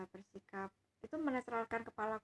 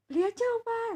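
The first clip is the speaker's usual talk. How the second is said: put-on much higher and louder, a small girl begging; little child voice; 12 semitones higher, 11 dB louder than usual. Granddaughter eagerly tells grandpa to just buy. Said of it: "Anak kecil kan ngomongnya gitu ya".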